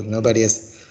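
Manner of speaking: usual speaking voice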